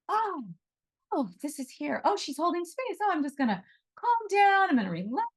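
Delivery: high, sing-song mock relief; mock animal voice, high and sweet; mock sudden calm. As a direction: play the animal calming on cue, high and light. Mocks an animal calming instantly.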